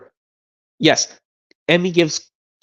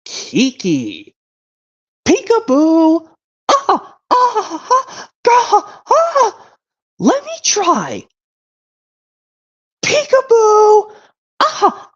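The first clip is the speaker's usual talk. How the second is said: very high, slow, loud playful cartoon voices; cartoon dragon voices; 4 dB louder, much slower than usual. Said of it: "that goes like this".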